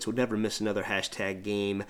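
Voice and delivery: normal talking voice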